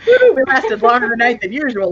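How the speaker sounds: normal talking voice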